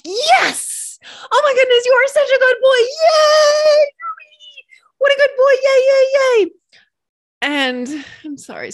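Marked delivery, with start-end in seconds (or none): 0.0-6.5 high, loud, gushing praise for a good puppy; high squealing shouts of yay to a good boy; puppy cheerleader praise. Retold or imitated. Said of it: "We become, like, cheerleaders".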